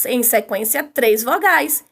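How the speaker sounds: normal talking voice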